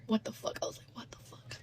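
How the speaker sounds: hushed whispered shock. Retold or imitated. Hiding in the closet, whispering in shock. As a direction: whisper it in disbelief.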